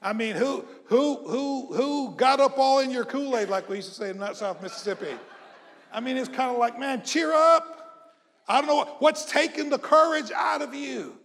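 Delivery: rally them with loud, high exhortation; rousing preaching. Real, not acted. Preacher urging a discouraged congregation to cheer up, rousing preaching.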